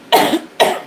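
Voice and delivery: unvoiced choking cough; breathy cough. Real, not acted. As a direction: cough to clear the airway. Food went down wrong pipe.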